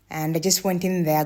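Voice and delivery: ordinary talk, nothing marked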